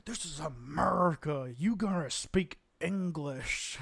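mock a blustering American laying down the law; typical demanding American. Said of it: "I sound like the typical American".

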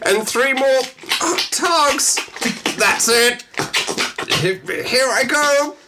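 high, hammed-up Irish voice, rising to a cry; Irish accent. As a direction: camp Irish lilt, egging on in a high voice. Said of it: "started doing an Irish accent".

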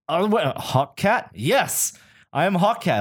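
high-pitched, drawn-out imitation of a proud reveal; mock triumph. Costumed stranger confirms his character to photographer, mock triumphant.

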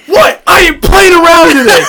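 high, loud imitated shout, laughing; retold yell. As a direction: challenge the log, half laughing. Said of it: "he yelled at the log".

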